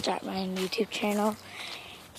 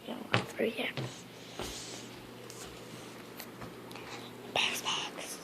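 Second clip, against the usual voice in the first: whispering; 13 dB quieter than usual. Real, not acted. Whispering while others sleep.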